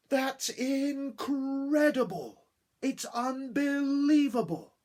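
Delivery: high, loud and slow astonishment; amazed. Shown as a demonstration. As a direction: gasp it out in wonder. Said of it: "we would have done something like this".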